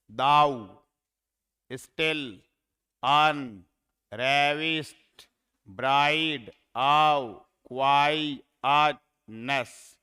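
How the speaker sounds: slow, punched syllables with gaps between them. Shown as a demonstration. Teacher scanning a line of verse for students, syllable by syllable. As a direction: break each word apart for counting. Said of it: "में तीन syllable हैं".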